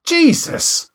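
loud, very high-pitched outburst; startled exclamation. Acted. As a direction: yelp it out in alarm. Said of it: "I exclaimed".

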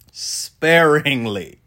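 "Sparingly" stretched very slowly, each syllable drawn out; dramatic drawn-out emphasis. Shown as a demonstration. To the viewers, stretching "Sparingly" very slowly for effect. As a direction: let "Sparingly" hang long and heavy. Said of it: "dramatic pause".

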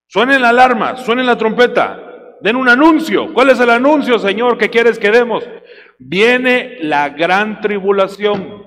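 slow, loud scripture proclamation; preaching at full force. Acted. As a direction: thunder the verse to the church. (predicación lenta y fuerte)

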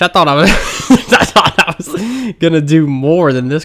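exasperated laugh through the words. Real, not acted. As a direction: laugh at the hassle. Exasperated by long sign-up.

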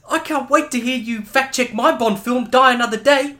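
put-on high, gushing Kiwi accent through laughter; a cheesy New Zealand fan voice, laughing; attempted Kiwi accent. Voicing the director Lee Tamahori on request in a laughing, gushing Kiwi accent. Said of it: "Do the Kiwi accent".

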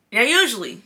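ordinary talk, nothing marked

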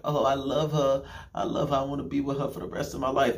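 mocking lovesick simp. Mocking his younger self gushing about his girlfriend, lovesick. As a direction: play your past self as a besotted fool.

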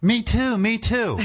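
high nervous squeak; scared kid blurting it out. Retold or imitated. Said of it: "I could not get the words out".

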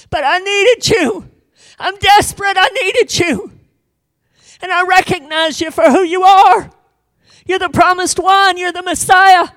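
high, loud, pleading cry; desperate plea. Acted. Woman pleading before Jesus, high and loud. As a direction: beg with desperate urgency. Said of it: "saying something like".

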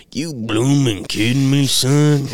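loud, high, drawn-out country exclamation; big country dude impression. Retelling the coach's clean swearing, exaggerated country holler. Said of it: "that's literally what he sounds like".

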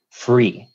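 pause, then punch "free"; stress on free. Shown as a demonstration. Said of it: "I wanna put an emphasis on the word".

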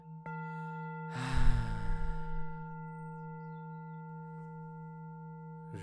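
long breathy release of air; audible exhale. Shown as a demonstration. (exhalación audible y larga)

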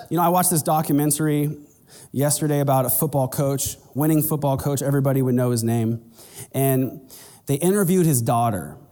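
ordinary talk

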